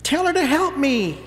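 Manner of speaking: put-on a kid tattling on a sister, whining; tattletale whine. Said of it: "being tattletales".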